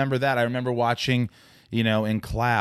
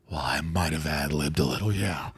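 low, soft star voice; Clint Eastwood; 7 semitones lower, 3 dB quieter than usual. Acted. Film star owning up to ad-libbing, Clint Eastwood impression. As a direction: keep it down low and understated. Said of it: "Clint Eastwood".

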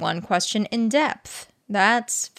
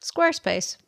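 slogan read; 9 semitones higher than usual. Shown as a demonstration. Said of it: "that's their new slogan".

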